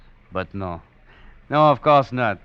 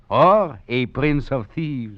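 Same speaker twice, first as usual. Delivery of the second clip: slow and louder, savoring each word; grand flourish; 3 semitones higher, 8 dB louder, much slower than usual. Acted. Duplessis boasts to the knife maker, slow and grand. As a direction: draw it out like a toast to thieves. Admiring the blade, dramatic flourish.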